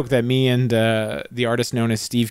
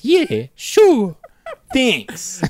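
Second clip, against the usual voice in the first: much higher mock Brooklyn reply; answering the call in Brooklyn accent; 11 semitones higher, much slower than usual. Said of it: "And he goes".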